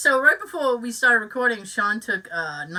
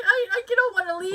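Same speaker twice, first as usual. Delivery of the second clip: laughing; 10 semitones higher than usual. Retold or imitated. Repeated retold plea, laughing.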